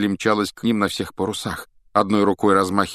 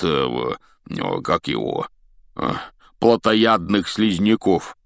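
slow, rumbling giant's voice; a big gamekeeper talking it over slowly; Hagrid voice; 2 semitones higher, 4 dB louder than usual. Acted. Hagrid explains slowly to Harry, rumbling.

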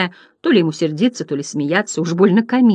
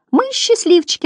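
very high, gleeful clown cry; jubilant yelling; 14 semitones higher than usual. A narrator's reading. Delighted butterflies to each other, high jubilant cry. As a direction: crow it out high. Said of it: "орали они".

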